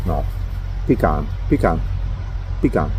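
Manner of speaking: ordinary talk, nothing marked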